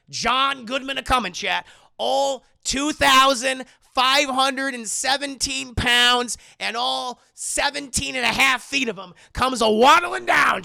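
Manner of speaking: hammed-up announcement. Storyteller announces the huge man coming, hammed-up and loud. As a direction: herald a giant's lumbering arrival.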